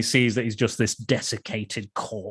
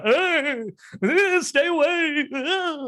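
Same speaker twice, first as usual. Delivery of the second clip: high, slow hammed-up fright; a frightened passer-by shrieking stay away; frightened villagers; much slower than usual. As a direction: shrink back from him in mock terror. Villagers recoil from the ghost.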